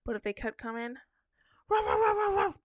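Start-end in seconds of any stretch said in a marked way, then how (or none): 1.7-2.6 high yappy dog barks; barking like a dog. Pretend. Barking at a creep who won't leave. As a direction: bark at him like an angry little dog. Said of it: "I'd bark".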